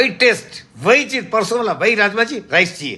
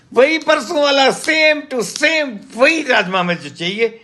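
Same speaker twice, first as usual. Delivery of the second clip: SAME TO SAME drawn out slowly, high; retold demand, dragging out "same to same"; insistent demand; 6 semitones higher, slower than usual. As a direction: stretch "same to same" and "वही" emphatically, pitched up. Customer's insistent demand, retold by waiter.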